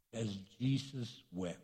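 very soft, low and grave; hushed verse reading. Real, not acted. Preacher reads the shortest verse to the congregation, hushed. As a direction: let it drop to a hush.

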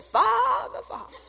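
high, pleading cry to the Father; pleading prayer. Retold or imitated. Praying woman crying out to God, high and pleading. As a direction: call out to God in high longing.

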